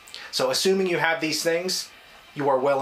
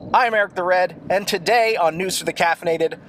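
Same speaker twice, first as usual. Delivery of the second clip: upbeat loud host intro; show host intro; 5 semitones higher, 4 dB louder than usual. Acted.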